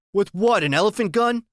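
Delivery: high, loud sarcastic jab; sarcastic cop sneering at the boy; mocking cop. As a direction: scoff at the idea like a wisecracking cop. Cop mocks the boy's strength.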